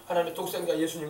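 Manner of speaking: ordinary delivery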